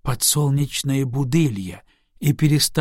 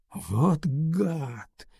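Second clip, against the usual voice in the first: amazed; 2 semitones higher, 5 dB quieter than usual. Acted. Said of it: "изумлённо самому себе сказал Алексей".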